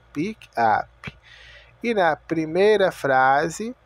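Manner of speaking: usual speaking voice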